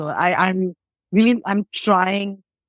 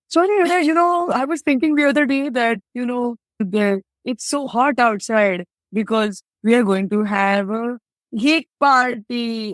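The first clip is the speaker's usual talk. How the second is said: mock long-winded man; 4 semitones higher than usual. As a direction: ham up a man dragging out a pointless story. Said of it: "is to be like".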